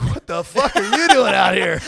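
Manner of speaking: loud, high, laughing disbelief; hammed-up shout of total bafflement; incredulous shout. British soldier finding an American in the woods, incredulous shout.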